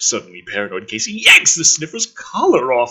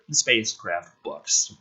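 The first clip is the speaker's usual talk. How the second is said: ordinary delivery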